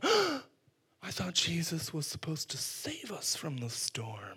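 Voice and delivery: gasping whisper; hushed dismayed whisper; shocked whisper. Acted. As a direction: whisper it in horror.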